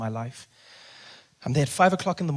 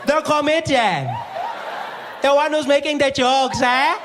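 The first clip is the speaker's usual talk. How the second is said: high, loud, drawn-out taunt; accusing receptionist; 13 semitones higher, 8 dB louder, slower than usual. Retold or imitated. Receptionist confronts comedian about jokes, high accusing shout. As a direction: accuse loudly, stretching the words.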